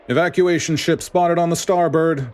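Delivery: high, loud call from the lookout; lookout yelling. A narrator's reading. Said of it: "a lookout yelled".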